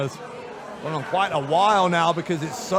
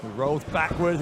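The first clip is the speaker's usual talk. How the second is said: ordinary delivery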